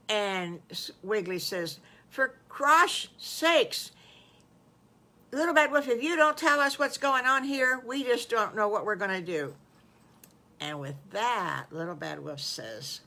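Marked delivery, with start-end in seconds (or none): 2.1-9.5 loud, high, fed-up outburst; impatient pig at the end of his patience; exasperated demand. Acted. Wiggly exasperated at the suspense.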